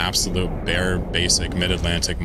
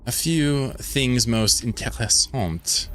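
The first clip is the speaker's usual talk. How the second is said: put-on fancy French accent; 4 semitones higher, much slower than usual. Mock fancy word.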